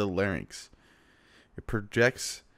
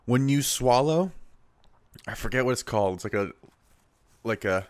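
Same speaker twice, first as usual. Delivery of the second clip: ordinary delivery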